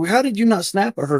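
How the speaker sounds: high-pitched incredulous question; marvelling, pitched well up; amazed questioning. Retold or imitated. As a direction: ask in retold astonishment, voice lifting high. Retelling amazement at boss's restraint.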